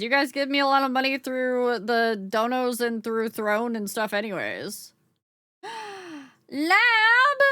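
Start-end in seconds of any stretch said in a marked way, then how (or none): 5.6-7.4 a high, loud burst of surprise; excited greeting. Real, not acted. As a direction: call the name out, delighted.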